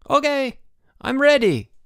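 very high, carrying reply; calling boy. A narrator's reading. Boy in the basket answering Bruno, calling. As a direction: call back down high and ready. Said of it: "called the boy".

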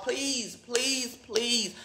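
almost under the breath, drained and pleading; quiet begging. Real, not acted. Asking viewers to repost so she gets her belongings, softly begging.

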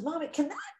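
put-on high, questioning child voice; small child's wondering question, pitched well up; little girl. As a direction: play a little girl puzzling something out for mom. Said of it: "the little girl says".

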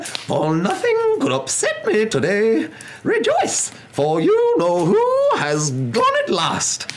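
very high, loud, squeaky jubilation; squeaky old man. Acted. Tiny old man rejoicing to a stranger, squeaky voice. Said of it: "he said in a squeaky voice".

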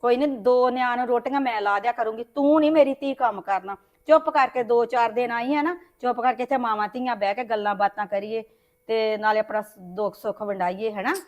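soft, low motherly reassurance; tender comfort. Acted. Mother softly comforting her troubled daughter, tender and hushed.

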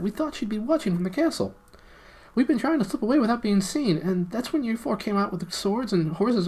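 high, eager little gnome pleading. Acted. Gnome Golg explaining to the prince and friends, high and eager. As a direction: squeak it out like a small underground gnome.

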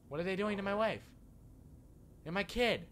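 high, loud alarm; alarmed protest. Pretend. Player watching his wife and kid in danger, alarmed. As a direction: cry out in mounting alarm.

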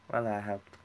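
ordinary delivery

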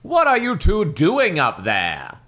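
slow, loud, high dad demand. A narrator's reading. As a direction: draw it out as a suspicious call. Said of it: "Dad demanded".

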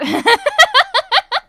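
a big squealing laugh; real amused laugh; loud high laugh. Real, not acted.

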